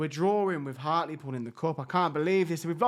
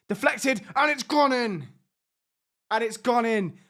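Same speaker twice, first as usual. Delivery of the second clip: real burst of excitement, pitched up; higher, thrilled match commentary; excited goal call; 4 semitones higher, 3 dB louder than usual. Real, not acted. Calling a goal as it happens.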